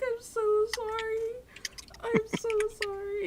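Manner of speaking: high, teary apology; real tears. Real, not acted. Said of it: "I'm actually, like, slightly crying".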